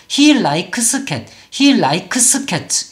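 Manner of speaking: he likes cat with likes stretched into "la-i-keu-seu"; Koreanized likes. Shown as a demonstration. Teacher shows the lingering learner mistake, Koreanized likes. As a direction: add "eu" vowels inside and after likes. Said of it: "이렇게 소리를 내는데".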